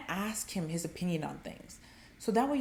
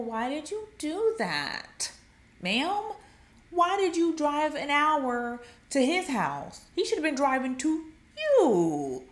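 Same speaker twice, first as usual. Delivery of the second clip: high, slow, drawn-out disbelief; incredulous, stretching the key words; exasperated scolding; 5 semitones higher, 3 dB louder, slower than usual. Acted. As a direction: scold her with mock exasperation. Scolds women who drove to him.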